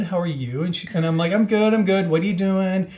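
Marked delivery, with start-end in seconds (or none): 1.3-2.9 higher, louder chirpy phone chat; eager small talk. Retold or imitated. Teller replays chatting to a caller she takes for a friend, eagerly. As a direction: keep up the breezy friendly patter.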